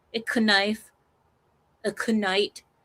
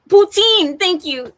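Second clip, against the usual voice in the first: much higher, loud shout of "poutine"; triumphant recall; 10 semitones higher, 10 dB louder, slower than usual. Real, not acted. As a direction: shout it the moment it comes back. Finally remembers the dish name.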